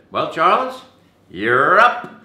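high, loud singsong summons; teacher calling out. Retold or imitated. Teacher summoning a nervous student, loud singsong call. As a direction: call the next student up, rising sharply. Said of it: "and I heard".

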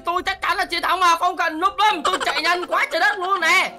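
usual speaking voice

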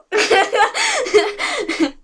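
high giggling burst; embarrassed high laugh. Real, not acted. Confessing her ship to viewers, high giggle. Admitting hardcore shipping.

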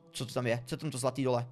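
ordinary talk, nothing marked

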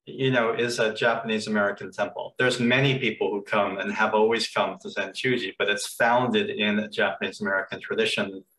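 ordinary delivery

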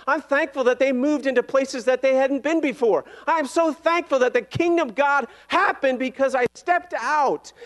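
high, loud impassioned preaching; rousing pulpit testimony; preaching at full force. Acted. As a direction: build the praise to a fervent peak. Sermon climax.

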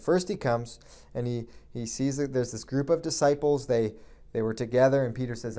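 usual speaking voice

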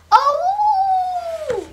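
long, very high, loud howl; wolf howl. A narrator's reading. Wolf landing in boiling soup, howling. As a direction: hold a high wailing "ow-ooo".

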